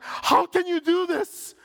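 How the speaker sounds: high, loud, outraged shouting; screaming reporter. Retold or imitated. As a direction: scream it at them in disbelief. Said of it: "he started screaming, yelling".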